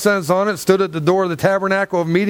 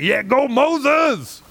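slow drawn-out cheer, higher; 7 semitones higher, much slower than usual. Retold or imitated. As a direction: cheer Moses on like a fan. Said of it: "I'd be like".

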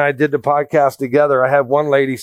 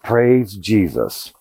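relieved thought; 6 semitones lower, much slower than usual. Retold or imitated. Inner relief at not warming up.

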